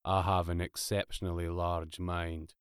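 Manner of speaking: low, soft, depressed drone; Marvin. A narrator's reading. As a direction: drone on in hopeless monotone. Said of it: "droned Marvin".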